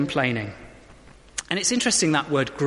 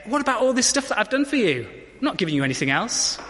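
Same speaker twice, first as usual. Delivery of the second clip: mock indignant; 7 semitones higher than usual. Imagined God refusing.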